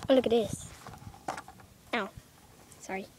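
usual speaking voice

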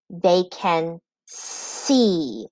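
"They can see", very slow, landing on a drawn-out see; split into syllables. Shown as a demonstration. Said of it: "sounds in each syllable".

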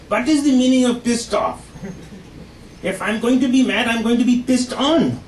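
put-on high Indian accent; father speaking with an Indian accent. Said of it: "He always just say".

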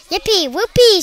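high, loud whoop of glee; shouted cheer. A narrator's reading. Giga Gargantula celebrating brains to eat, shouting. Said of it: "shouted the Giga Gargantula".

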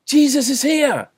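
high, loud shout of news; crier's high-pitched yell; excited shout. A narrator's reading. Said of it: "someone shouted".

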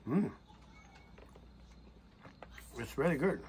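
talking while chewing food; muffled mid-bite hum; mouth full, chewing. Real, not acted. Seen 1: Bite at -2.0; lips closed and cheeks full while he says "Mm.

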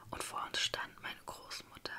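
ordinary delivery

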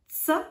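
"S" said as a short tsuh; German Z sound. Shown as a demonstration. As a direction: say "S" like the tsa in Vatsala. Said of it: "So the Z is".

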